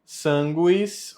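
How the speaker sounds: "Sanguis" said SAN-gwees; sounded U. Shown as a demonstration. Teacher showing the U must be said, careful demo. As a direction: let the U be heard in "Sanguis". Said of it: "esse U ele precisa ser dito".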